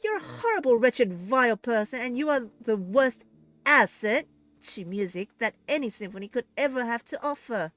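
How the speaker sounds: high, loud furious tirade; angry shouting. A narrator's reading. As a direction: tear into the villain. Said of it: "Eliza shouts".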